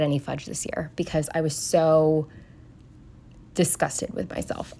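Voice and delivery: hushed ashamed whisper. Real, not acted. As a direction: drop to a whisper as if confessing. Ashamed confession, voice dropping.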